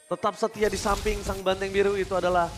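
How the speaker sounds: usual speaking voice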